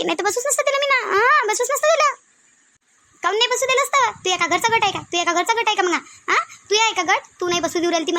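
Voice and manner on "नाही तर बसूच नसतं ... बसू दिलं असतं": high-pitched indignant protest; indignant quarrelling. Acted. Woman protesting to Shantabai about a rival member, indignant. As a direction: argue back, pitch climbing high.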